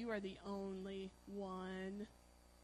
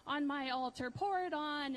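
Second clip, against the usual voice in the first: taunting dare; 4 semitones higher, 6 dB louder than usual. Retold or imitated. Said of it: "he's taunting them".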